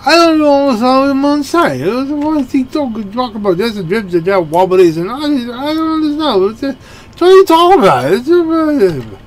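hammed-up bewildered rocker, words slurring. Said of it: "even [name] was like".

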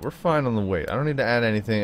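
usual speaking voice

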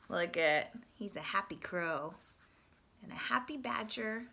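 plain reading voice

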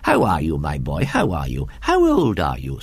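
lightly voiced eager gentleman, low; a touch of delighted welcome in character; delighted elderly host. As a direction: greet the boy with brisk delight. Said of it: "rubbing his hands with delight".

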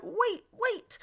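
high, urgent retold cry. Brandir begs the fleeing woman to stop, crying out high. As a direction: call out high as if to stop someone. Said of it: "Brandir cries out".

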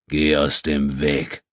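slow, menacing growl. A narrator's reading. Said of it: "grollte der Nubier".

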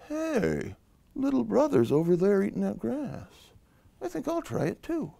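high, soft goat musing; middle goat, hopeful. Acted. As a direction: think aloud as an eager goat, high and soft.

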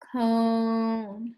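CONE with the O held long; drawn-out slow. Shown as a demonstration. Teacher helping pupils hear the vowel, drawn-out slow CONE. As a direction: sound out CONE slowly and evenly. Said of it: "Do you hear long O".

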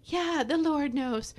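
high singsong mock reassurance; mock soothing flatterer. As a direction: coo empty comfort like a flatterer. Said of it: "somebody never tell you and just say".

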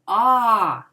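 А said loud and drawn out; the stressed vowel А, loud for the children. Shown as a demonstration. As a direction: sing out the vowel А. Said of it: "ми чуємо голосно звук".

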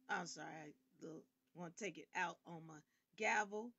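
ordinary talk

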